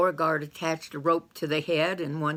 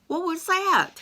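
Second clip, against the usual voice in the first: startled; 13 semitones higher, 3 dB louder than usual. Acted. Carl startled by a hiss.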